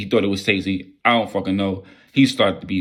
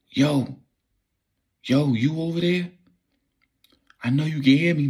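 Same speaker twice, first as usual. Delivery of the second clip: higher, strained voice calling out from the dark; a nervous stranger whispering out to someone he can't see; unseen stranger; 6 semitones higher than usual. Retold or imitated. Stranger in the dark calling to him, higher and strained.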